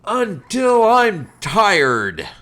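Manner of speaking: very slow, loud, drawn-out threat; menacing order. Retold or imitated. Drill instructor setting endless push-ups, slow menace. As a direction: draw out each word as a threat. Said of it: "And he goes".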